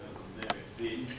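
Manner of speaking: a hint of a deep mysterious voice; low window voice. Shop window naming the boy, low and soft. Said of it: "The butcher shop window said".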